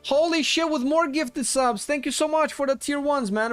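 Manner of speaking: high, loud hype; excited shout. Real, not acted. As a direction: yell it out in delight. Hyped thanks for gifted subs.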